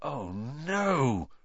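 put-on a soft, gasped oh no in a boy's voice; gasped dismay. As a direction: let the dread slip out under your breath. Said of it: "Ron gasped".

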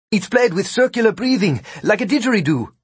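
high-pitched character voice; explaining Vikram. A narrator's reading. Vikram explaining his flute to the magicians, informative.

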